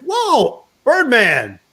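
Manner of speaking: high, startled whoa of recognition; surprised teammate spotting an old friend on the train; startled surprise. Retold or imitated. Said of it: "He's like".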